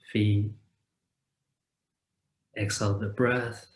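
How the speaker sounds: calm meditation guide with long, unhurried pauses; guided meditation. Acted. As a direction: guide listeners gently into rest, leaving space after each phrase.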